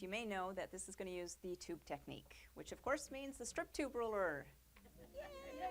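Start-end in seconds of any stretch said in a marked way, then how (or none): 5.1-5.7 high squeal of delight; a high, thrilled little yay; excited cheer. Acted. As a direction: cheer it like a fan. Cheering the strip tube ruler.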